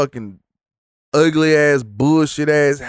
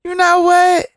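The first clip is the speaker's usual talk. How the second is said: higher, louder insistent repeat; the fan lady doubling down on her point, higher and louder; gushing fan lady, more emphatic; 5 dB louder than usual. Retold or imitated. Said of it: "And so she say".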